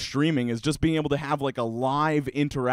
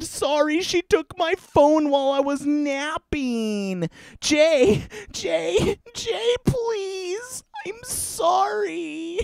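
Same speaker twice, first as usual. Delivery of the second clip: very high, whiny, sobbing begging; desperate crying plea; 11 semitones higher, slower than usual. Acted. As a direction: wail for him to take her back. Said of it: "apologetic and sad".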